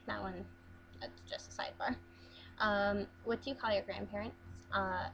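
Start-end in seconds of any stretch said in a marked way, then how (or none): 0.1-2.0 breathy whispered sidebar; a quick whispered aside to the viewers. Real, not acted.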